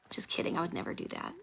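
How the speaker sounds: fast, hushed whisper; quickly whispering to viewers that it was a joke. Real, not acted. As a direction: hurry the take-back under your breath. Taking back the joke.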